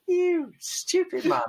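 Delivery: high, drawn-out comic scolding; put-on high voice, stretched out slowly; mock scolding voice. Runner's legs berate him mid-race, mock scolding.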